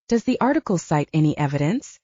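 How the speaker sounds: ordinary delivery, nothing marked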